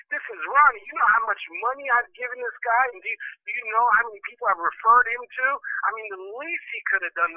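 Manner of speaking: retold worked-up objection; indignant protest. Acted protest at friend's advice.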